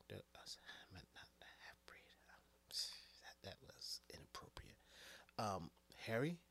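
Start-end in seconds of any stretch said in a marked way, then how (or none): 0.1-4.8 hushed, embarrassed whispered apology; whispering. Real, not acted. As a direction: drop to a guilty whisper. Sheepish whispered take-back.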